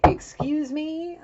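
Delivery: high, drawn-out incredulous "excuse me"; affronted woman. Retold or imitated. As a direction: stretch it upward in mock outrage. Acting the woman's affronted reaction.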